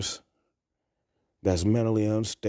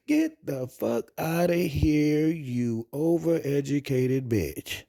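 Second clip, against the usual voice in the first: mock dismissal; 7 semitones higher, slower than usual. Brushing off the imagined woman.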